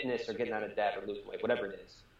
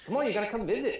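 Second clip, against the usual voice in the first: laughing; 11 semitones higher, 4 dB louder, faster than usual. Real, not acted. Laughing invite to visit Cincinnati.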